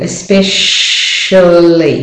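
"especially" slowed with a long sh and barely any vowel before lee; weak vowel. Shown as a demonstration. Teacher showing the A is hardly there, slow "especially". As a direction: say "especially" as espe-shhh-lee, swallowing the A. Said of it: "it's the weak form".

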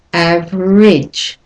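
"average" said slowly with a lengthened ending; slow, careful model of "average"; slow demonstration. Shown as a demonstration. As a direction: stretch "average" out for learners. Teacher repeats the word slowly.

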